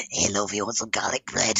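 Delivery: a pretend suitor murmuring a low, soft offer; low smarmy offer. Streamer voicing a chat message offering garlic bread, low soft put-on voice.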